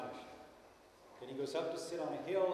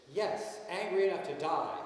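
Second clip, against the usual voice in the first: loud, higher, furious retort; angry retort; 4 semitones higher, 8 dB louder, slower than usual. Retold or imitated. Jonah answering God to his face, loud and furious. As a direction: fling it back at God in anger. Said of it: "This is, this is how angry he was".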